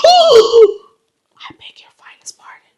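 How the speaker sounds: slow, breath-only whisper of indignation; scandalized whisper. Retold or imitated. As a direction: whisper it slowly, utterly affronted. Scandalized reply, acted out.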